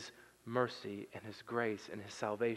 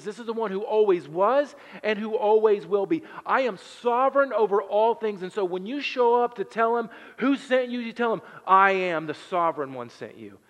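loud, driving sermon voice; preaching at full force; 7 semitones higher, 9 dB louder than usual. Acted. Pastor stirring the congregation, loud preaching. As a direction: preach it out with rising fire.

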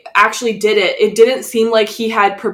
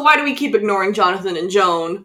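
normal talking voice

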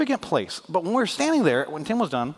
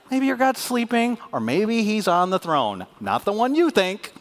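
sarcasm; 8 semitones higher, slower than usual. Retold or imitated. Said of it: "That's sarcasm".

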